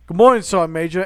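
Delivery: loud bright movie-quote greeting; loud cheerful hello to the sergeant; cheery greeting. Retold or imitated. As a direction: boom out a cheerful good morning. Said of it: "And he goes".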